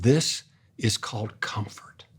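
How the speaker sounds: hushed slow whisper, each word laid down softly. Retold or imitated. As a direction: breathe the words close to the child's ear. Grandpa holding grandson, naming comfort.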